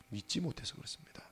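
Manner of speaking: breathy whispered echo of 못해서 with a rise; hushed confiding aside. Real, not acted. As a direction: drop to a whisper on 믿지 못해서 그렇습니다.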